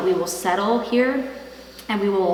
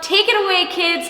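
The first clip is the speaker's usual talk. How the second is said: raised, high sign-off call; 11 semitones higher, 4 dB louder than usual. Real, not acted. Calling to kids to close vlog.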